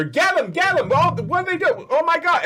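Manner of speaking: high-pitched frantic market cries; panicked crowd. Acted. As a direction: yelp like a flustered stallholder chasing pests. Panicked market crowd chasing bunnies.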